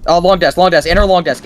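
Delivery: high, loud rapid callout; urgent callout. Real, not acted. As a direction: call the position urgently.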